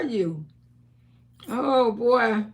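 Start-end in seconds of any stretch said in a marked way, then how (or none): none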